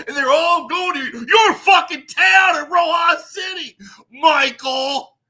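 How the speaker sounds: loud, high rant rising to a yell; furious shout. Real, not acted. Ranting at Michael about broke foreigners, loud high shout. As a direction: shout it at him across town.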